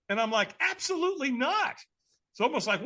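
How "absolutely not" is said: very high, incredulous refusal; emphatic refusal. Retold or imitated. Scared man refusing a stranger's firewalk offer, emphatic refusal. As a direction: squeak it out as an outraged no. Acts out flat refusal to walk.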